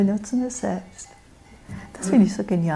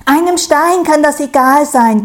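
high, loud spiteful jab; snide; 6 semitones higher, 10 dB louder than usual. A narrator's reading. Stroh sneering about the stone to Sau, high and spiteful. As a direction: needle it spitefully, pitched high. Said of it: "giftelt Stroh".

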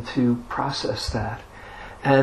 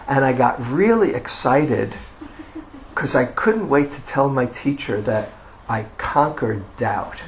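ordinary delivery

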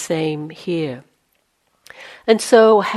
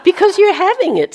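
laughing; 14 semitones higher, 4 dB louder than usual. Real, not acted. Teacher laughs answering yes.

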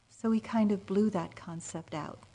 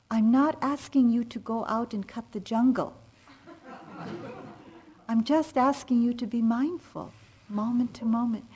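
louder, a touch higher, mock stern teacher; wisely impatient; 5 semitones higher, 5 dB louder than usual. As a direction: lightly voice a patient-but-firm teacher. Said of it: "wisely impatient with me".

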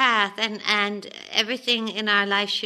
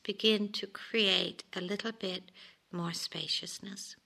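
whisper; 9 dB quieter than usual. Real, not acted. Teacher whispering to retreatants about spaciousness, hushed. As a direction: drop to a whisper, slowing on the last words. Softly guiding listeners inward.

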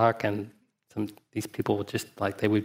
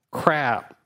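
put-on overdone Southern accent; 4 semitones higher than usual. A sangha member jokes about the fallen master in a put-on Southern accent. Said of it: "in a maybe slightly overdone Southern accent".

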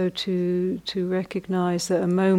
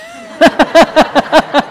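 loud laugh; 9 semitones higher, 15 dB louder than usual. Real, not acted. Laughing at forgotten joke.

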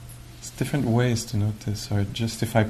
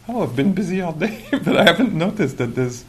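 higher voice with a laugh in it; amused realization; 7 semitones higher, 4 dB louder than usual. Retold or imitated. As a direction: chuckle at your own forgetfulness. Acts sudden noticing, amused.

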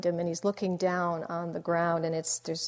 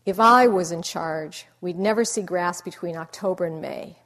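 declaring; 8 dB louder than usual. Retold or imitated. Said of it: "So he says".

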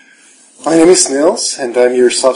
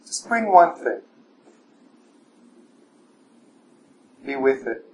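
guided meditation; 7 dB quieter than usual. Acted. Teacher guiding the evening class through standing meditation, soft and calm. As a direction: guide the room gently inward, unhurried.

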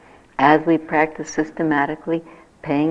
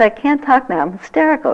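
higher, louder, breathless with joy; excited brush-off; 7 semitones higher, 5 dB louder than usual. Retold or imitated. Brushing off a caller on the phone, excited and loud. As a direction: blurt it out to the caller, too happy to talk. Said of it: "And I was hysterical".

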